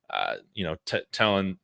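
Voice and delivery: usual speaking voice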